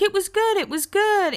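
high mock chirp of Lo; voicing Lo. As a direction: play Lo chirpy and brief. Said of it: "Lo's like".

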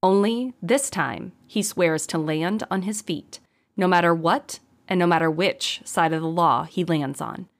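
plain reading voice

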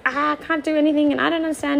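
replaying her overwhelmed meltdown to viewers; frantic outburst.

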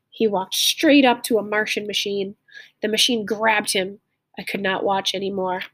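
clear, lively read-aloud storytelling; story narration. A narrator's reading. Teacher reading a story aloud to learners, lively storytelling. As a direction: read the tale to the class with feeling.

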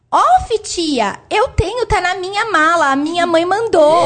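put-on high little boy voice; child voice. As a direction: chirp it like a three-year-old. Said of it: "Aí ele virou e falou assim".

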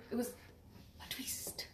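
breathy secret whisper. Real, not acted. Confiding the big twist.

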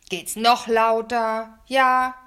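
loud, drawn-out angry bellow; booming indignant outburst; blustering. A narrator's reading. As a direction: thunder it slowly like a furious neighbour. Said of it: "poltert er los".

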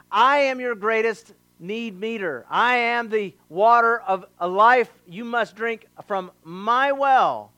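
preacher voicing Jesus' claim; bold declaration. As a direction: declare it with authority.

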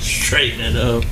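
mock-serious slow repeat of Straighten it up. Repeats the tease, now low.

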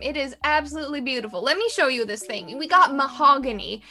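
a pushy Southern peddler pressing a sale; southern accent. Acted. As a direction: coax her to buy, Southern style. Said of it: "the southern accent really surprised me".

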